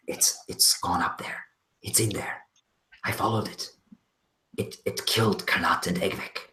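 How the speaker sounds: hushed, unvoiced whisper. Valky whispers to Urda among the rocks, hushed. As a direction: whisper it so the creature can't hear. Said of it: "she says".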